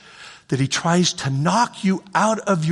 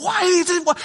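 whiny why; 10 semitones higher than usual. Acted. Voicing believer's complaint.

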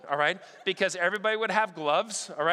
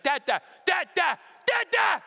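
put-on higher, louder toddler shout, building; 9 semitones higher, 5 dB louder than usual. Two-year-old demanding his dad's attention, shouting louder and higher. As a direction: yell for dad like a kid left out. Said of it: "He's like".

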